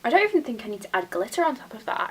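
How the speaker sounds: ordinary delivery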